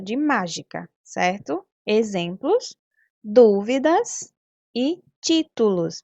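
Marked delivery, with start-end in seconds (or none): 3.2-6.0 "dúvidas" and "títulos" drawn out, leaning on DÚ and TÍ; stressed first syllable. Shown as a demonstration. Teacher showing third-graders the tonic syllable in "dúvidas" and "títulos", slowly stressed. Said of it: "Percebam que a sílaba tônica está destacada".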